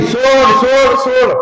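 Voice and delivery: high, loud frantic shriek; screaming let go. Acted. Demoness Putana screaming at baby Krishna to let go, high and loud. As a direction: scream to be released, pitch way up. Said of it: "ओरडायला लागली".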